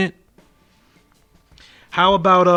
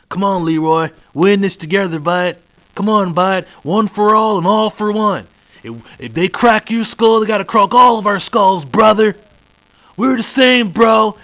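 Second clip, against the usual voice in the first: higher, louder put-on comrade voice; mock eager buddy; 4 semitones higher, 5 dB louder than usual. Supposed friend urging Leroy to stick together, mock eager buddy. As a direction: imitate an overeager pal rallying the troops.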